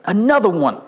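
lightly voiced hype catchphrase; a hint of a hype-man impression. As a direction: toss it out like a catchphrase. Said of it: "I feel like [name]".

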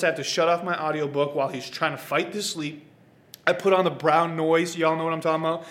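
ordinary delivery

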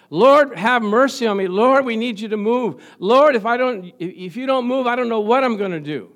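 loud, pleading cry to the Lord; desperate prayer. Acted. Believer crying out to the Lord for help, loud pleading.

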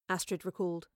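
plain reading voice

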